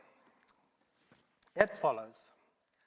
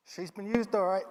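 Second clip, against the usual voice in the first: proud; 9 semitones higher, 15 dB louder than usual. Acted. Owner proud of the worn truck.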